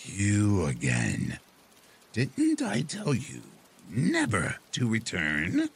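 put-on slow, stern old witch voice; displeased witch. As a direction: scold him like a cross witch. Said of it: "her face darkened".

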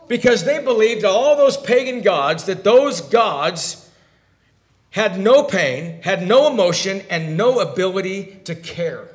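loud, hammered sermon phrases; emphatic preaching. Acted. Preacher on uncaring pagan gods, loud and emphatic. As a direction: drive each phrase home from the pulpit.

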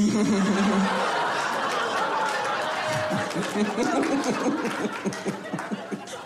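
laughing at her own dark joke; airy laugh that trails off; long breathy laugh. Real, not acted. Comic laughing at her dark joke.

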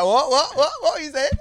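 high, squeaky confused voice; squeaky what what what; mock confused dude. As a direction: play the baffled guy, pitched right up. Dude's confused reply.

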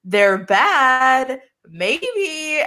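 high, hammed-up spooky "ooh, they're bad"; mock dramatic suspense, pitched high; mock ominous tease. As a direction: tease it like a cheesy villain reveal. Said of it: "this episode is trying to be like".

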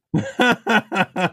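wild cackling laugh; maniacal cackle. Real, not acted. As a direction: cackle like a mad scientist. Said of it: "what [name] is cackling maniacally over".

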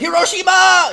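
loud, high mock Japanese shout; exaggerated, shouted Japanese accent. Shown as a demonstration. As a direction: blurt it as a hammed-up Japanese impression. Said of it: "I was gonna be like".

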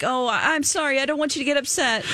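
high, rushed, stammering apology, imitating Alexis; mock panic that tips into exasperation; flustered Alexis. Cohost imitating Alexis breaking under the prank victim's anger, high and rushed. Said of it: "she's like".

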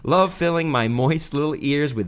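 put-on reading in an Australian accent. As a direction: lay on the Aussie accent. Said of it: "that was actually his Australian accent the whole way through".

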